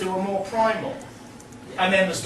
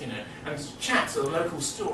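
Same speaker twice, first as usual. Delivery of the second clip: ordinary talk, nothing marked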